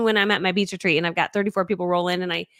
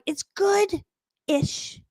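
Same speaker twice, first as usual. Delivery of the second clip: high, drawn-out reluctant verdict; hesitant hedge; 10 semitones higher, much slower than usual. Retold or imitated. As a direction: stretch it out high, hedging the praise. Hedging feedback on someone's video.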